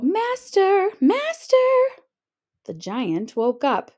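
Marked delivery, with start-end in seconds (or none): 0.0-1.6 high, loud alarmed cry; calling out. A narrator's reading. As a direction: cry out for help. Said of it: "the harp called out".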